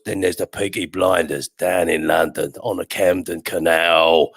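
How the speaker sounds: put-on a Birmingham gangster's accent, done with relish. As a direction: quote the show in a low Birmingham accent. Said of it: "as they say it themselves".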